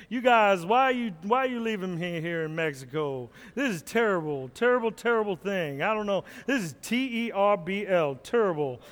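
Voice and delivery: the van pleads not to be left behind; Charles Barkley voice. Pretend. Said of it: "talking like Charles Barkley".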